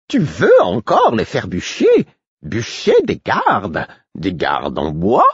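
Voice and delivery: slow, swooping jester's musing; mocking fool. Fool punning on wooden guards, mocking. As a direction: toy with the words, drawn out. Fool's riddling mockery.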